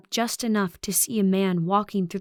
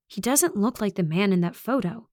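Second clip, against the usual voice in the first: usual speaking voice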